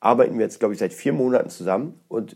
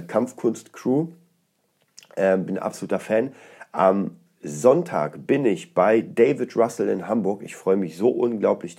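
normal talking voice

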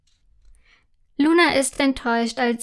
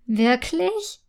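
ungläubig; 4 semitones higher than usual. A narrator's reading. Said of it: "entgegnet Stella ungläubig".

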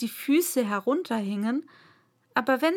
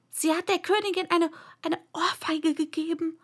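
high-pitched, confiding rabbit; nervous rabbit voice; 7 semitones higher than usual. A narrator's reading. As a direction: tell the secret as a nervous rabbit. Timid rabbit gossips about the queen.

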